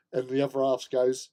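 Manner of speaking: normal talking voice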